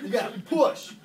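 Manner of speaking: usual speaking voice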